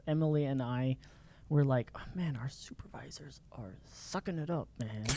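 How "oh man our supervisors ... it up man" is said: hushed grumble. Retold or imitated. Staff complaining between themselves about supervisors, hushed. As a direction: grumble it under your breath.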